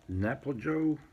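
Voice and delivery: ordinary delivery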